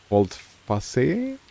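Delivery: "Volte face" said in an Italian accent, "face" as "fasse"; Italian accent try. Shown as a demonstration. Streamer asked to say it the Italian way, Italian accent. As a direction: give "Volte face" a hesitant Italian accent, ending "fah-seh". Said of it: "Pronounce it with an Italian accent?".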